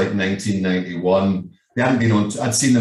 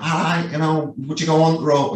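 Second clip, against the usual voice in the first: a light put-on Yorkshire accent, higher; mock Yorkshire accent; 6 semitones higher than usual. Imitating a Yorkshire recruiter on the phone, light Yorkshire accent. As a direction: mimic the Yorkshire caller on the phone. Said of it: "it's my Yorkshire accent".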